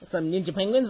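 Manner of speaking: ordinary talk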